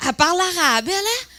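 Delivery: loud, high, doubting query; mock incredulous question. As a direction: question it loudly, playing the interviewer. Imite l'intervieweur étonné. (incrédulité jouée, voix forte)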